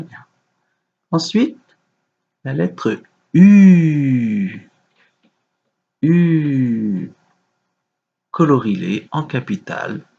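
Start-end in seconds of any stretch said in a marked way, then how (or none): 3.3-7.1 U held very long, gliding down; drawn-out letter. Shown as a demonstration. Teacher dictating a letter to a young pupil, drawn-out U. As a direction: stretch "U" out slowly, each time.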